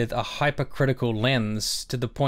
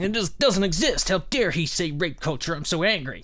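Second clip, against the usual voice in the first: mocking offended critic; 5 semitones higher than usual. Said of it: "'cause they think".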